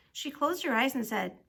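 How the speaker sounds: plain reading voice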